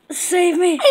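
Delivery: ordinary talk, nothing marked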